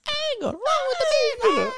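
imitating a squeaky liar in falsetto; very high, squealed impression of a fibber; mock high-pitched voice. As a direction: mimic the friend's voice shooting way up. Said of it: "When you hear me do that sound".

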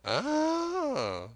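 high, slow, cracked voice of a wild marooned sailor; Ben Gunn. Acted. Marooned Ben Gunn eagerly pleading with young Jim, high and slow.

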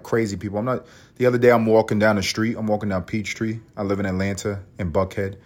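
ordinary talk, nothing marked

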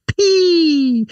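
a comic high-pitched "S P"; squeaky high P. Shown as a demonstration. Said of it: "Do I have to say the P like that".

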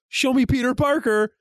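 high-pitched mock incantation; imitated summoning. Voicing them opening the portal, high mock summoning. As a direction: call up the portal, retold in a high voice.

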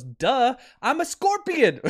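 high, emphatic acted retort; scorpion's mocking "duh". Scorpion answers the dying frog, high mocking retort. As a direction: toss it back as utterly obvious, pitched high. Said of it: "And the Scorpion goes".

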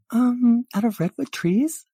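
put-on very high, girly nervous awe; high-pitched flustered onlooker; awed valley girl. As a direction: ask timidly in a high girlish voice.

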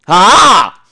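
a short loud "Ah!" blurted out; startled shout. Real, not acted. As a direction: burst out with a loud yelp. Host's sudden outburst mid-theory.

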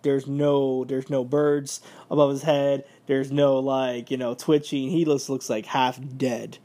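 ordinary talk

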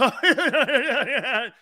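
high, slow laughing mock chuckle; mock a host's canned laugh; fake radio laugh. Imitating a radio host laughing on air, hammed-up. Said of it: "When a host goes".

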